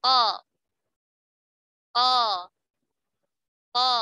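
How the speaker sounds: each Ko set apart by a pause, as a tajwid drill; qaf demo. Shown as a demonstration. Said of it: "Coba dirasakan".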